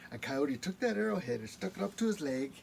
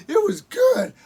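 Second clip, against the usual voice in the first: high loud wailing cry; crying shout; 15 dB louder than usual. Acted. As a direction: wail it out. Raven wailing.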